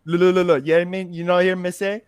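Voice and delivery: hammed-up Jamaican patois phrases. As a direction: mimic the patois rhythm, repeating look. Imitating Jamaican patois speech.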